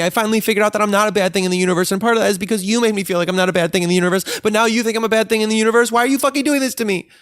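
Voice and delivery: spiraling into a frantic high-voiced meltdown; freaking out. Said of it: "freak out and go".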